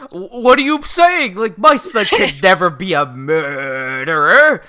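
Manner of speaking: loud, high stammer stretching into a drawn-out protest; hammed-up appalled father; indignant disbelief. Father protests his son's innocence.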